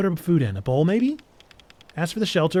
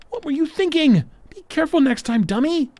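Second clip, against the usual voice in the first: scolding; 11 semitones higher, 4 dB louder than usual. Acted. Scolding friend after fall.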